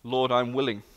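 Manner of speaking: normal talking voice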